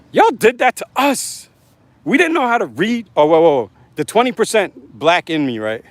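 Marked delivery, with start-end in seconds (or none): 0.1-3.1 high, put-on complaining voice; mock aggrieved voice. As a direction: whine it up high like a caricature.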